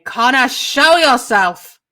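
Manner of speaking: loud, drawn-out challenging shout, pitched high; full-force demand yelled at someone out of sight; yell down hallway. Acted. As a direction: bellow it slowly down a long corridor. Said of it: "just going to yell down the hallway".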